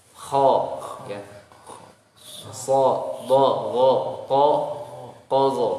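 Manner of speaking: kho, so, do, go said loud and dark toward O; thick syllables. Shown as a demonstration. Teacher models thick syllables after explaining them. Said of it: "dibaca dengan tebal".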